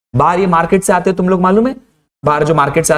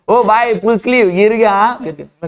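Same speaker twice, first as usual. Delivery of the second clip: higher-pitched street-seller call; hawking vendor; 5 semitones higher than usual. Retold or imitated. Market seller calls out to the man in full sleeves, mock hawking. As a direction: imitate a hawker singling out a passer-by. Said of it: "जोर जोर से चिल्ला रहा है".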